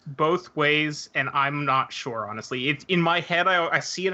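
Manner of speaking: ordinary talk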